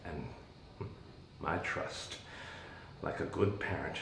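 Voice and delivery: soft, breathy half-whisper; hushed bitter murmur; hushed aside. Acted. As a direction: drop almost to a whisper. Bitter confession of misplaced trust.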